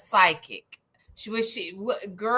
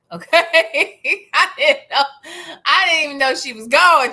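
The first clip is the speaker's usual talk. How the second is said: loud, laughing insistence; laughing protest; 4 semitones higher, 6 dB louder than usual. Real, not acted. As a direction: burst out laughing as you insist. Cracks up repeating herself.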